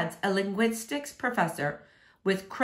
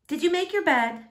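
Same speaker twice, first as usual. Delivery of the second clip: a higher motherly voice, brisk; mom's question; 7 semitones higher than usual. A narrator's reading. Mom quizzing her son in the morning, lightly voiced. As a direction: ask it like a mom checking up.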